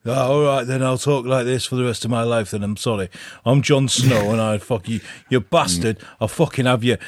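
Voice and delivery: put-on Northern English voice; Northern accent. Actor giving in to a fan, Northern accent. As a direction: grudgingly switch into broad Northern. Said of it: "Talk Northern".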